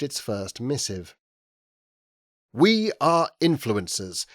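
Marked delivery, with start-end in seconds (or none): 2.5-4.3 block capitals. A narrator's reading. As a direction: declaim each word like a headline. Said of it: "it said in block capitals".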